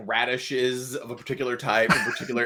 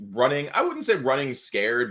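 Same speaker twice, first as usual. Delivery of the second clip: usual speaking voice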